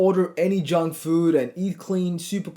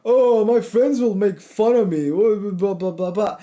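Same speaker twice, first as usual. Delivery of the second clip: mock whiny excuse; 4 semitones higher, 4 dB louder than usual. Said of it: "you could be like".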